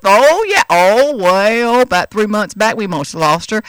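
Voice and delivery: a hint of the driver's drawl; Texas drawl. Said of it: "he talked like this".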